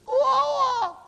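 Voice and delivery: put-on high, loud baby squawk; high-pitched toddler babble yell; toddler's first word. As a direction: imitate a baby's loud garbled first word. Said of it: "the first time they go".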